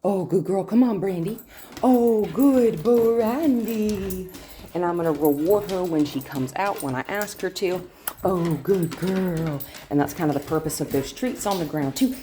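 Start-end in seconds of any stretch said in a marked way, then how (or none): 1.8-4.3 slow, high, louder sing-song praise; drawn-out praise. Real, not acted. Trainer praising Brandy coming out of the crate, slow sing-song. 8.2-9.6 stretched praise. Real, not acted. Praising dog.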